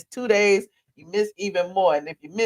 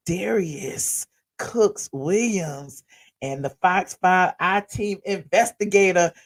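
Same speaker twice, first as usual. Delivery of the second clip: normal talking voice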